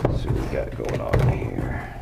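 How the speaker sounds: low voiceless whisper. Real, not acted. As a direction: breathe it as you peek inside. Unboxing, voice drops to whisper.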